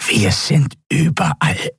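pressed, nasal creature croak; croaking reptile voice. A narrator's reading. Captive reptile taunting the admiral, croaking nasally. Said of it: "krächzte die gepresste, nasale Stimme".

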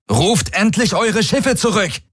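very high, urgent demand; exasperated call. A narrator's reading. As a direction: call out desperately, pitched right up. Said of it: "rief seine Projektion". (aufgebrachtes, sehr hohes Rufen)

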